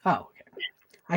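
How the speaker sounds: whispering. Real, not acted. Whispered mock relief.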